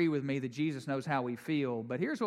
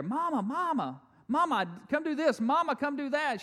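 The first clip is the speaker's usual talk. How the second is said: put-on high whiny kids calling Mama over and over; 11 semitones higher, 3 dB louder than usual. Kids in the kitchen nagging their mother, high whiny calls. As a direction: pester like little kids wanting mom.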